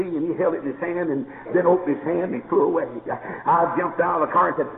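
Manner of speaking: normal talking voice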